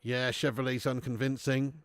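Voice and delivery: normal talking voice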